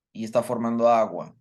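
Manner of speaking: ordinary talk, nothing marked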